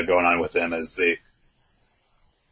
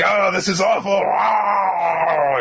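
mock wail into the mic; 9 semitones higher, slower than usual. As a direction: ham up a horrified shriek. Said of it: "Into the microphone".

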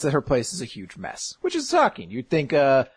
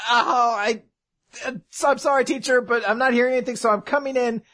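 higher-pitched, awkward acted apology; hesitant apology; 7 semitones higher than usual. Student warning teacher before entering her room, hesitant. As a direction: apologise nervously in a raised voice.

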